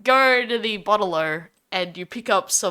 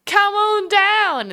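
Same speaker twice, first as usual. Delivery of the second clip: mock party host; 8 semitones higher than usual. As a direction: sell it like an eager host. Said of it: "people will invite".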